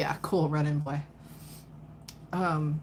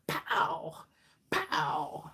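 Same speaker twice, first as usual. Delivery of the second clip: whispered pow pow sound effects; mock punches in a breath; 3 dB quieter than usual. Psychic to client, whispering mock punch sounds. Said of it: "you're literally like".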